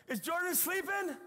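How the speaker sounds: high mock yell across the freeway; retold yell. Wife yelling from her car window on the freeway. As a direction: call it out over traffic noise. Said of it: "she yells at me on the freeway".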